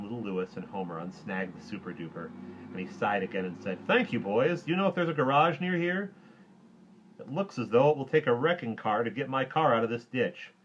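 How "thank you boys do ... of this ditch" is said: loud, raised-pitch grown-up hero; projected, higher comic-book hero; superhero character. Acted. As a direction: address the boys in a big hero voice. Superhero addresses the boys.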